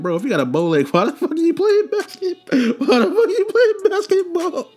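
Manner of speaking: high squeaky voice breaking with laughter; laughing incredulous. Real, not acted. Telling of the bow-legged kid playing basketball, squeaking with laughter. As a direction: crack up while asking why he plays.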